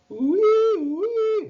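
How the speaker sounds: very high, loud siren wail; siren wee. A narrator's reading. Mouse making a fire engine siren wail. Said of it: "went Morris's siren".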